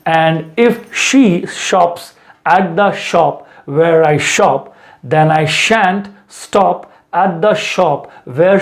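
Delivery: very slow, every sh drawn out; slow tongue twister. Shown as a demonstration. Teacher drills the sh and s tongue twister. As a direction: stretch each sh and s apart. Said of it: "मैं एक बार फिर पढ़ दे रहा हूँ".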